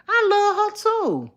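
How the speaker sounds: high, slow, held sweet note; high sweet sing-song. Real, not acted. Assuring her friend of love, high slow sing-song.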